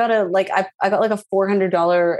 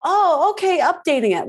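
high customer service voice; 9 semitones higher than usual. Retold or imitated. Phone agent voiced in story.

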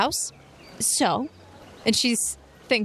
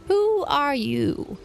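put-on old woman; 7 semitones higher, much slower than usual. GM voices elder.

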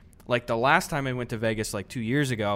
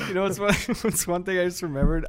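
laughing; 5 semitones higher than usual. Real, not acted. Laughing while starting story.

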